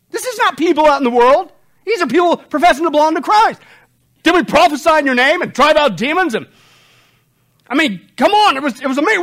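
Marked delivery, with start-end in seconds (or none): 4.2-6.3 pleading protest. Acted. Voicing the false believers again.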